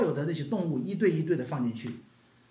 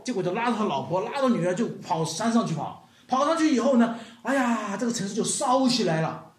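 ordinary talk, nothing marked

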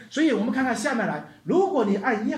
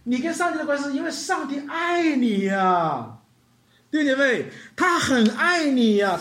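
normal talking voice

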